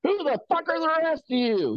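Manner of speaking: very high, sustained angry holler; irate old man. Retold or imitated. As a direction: mimic an old man raging at the whole group. Driver confronts the whole group.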